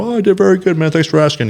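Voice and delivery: high, loud fake-happy brush-off; mock cheerful. Answering gym people after losing, fake cheerful. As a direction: chirp it brightly, pretending all is fine.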